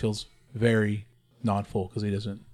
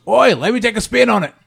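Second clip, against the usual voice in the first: loud, high rowdy shout; brash call of an eager brute; gruff character voice; 11 semitones higher, 9 dB louder than usual. Acted. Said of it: "looks at Joe, and goes".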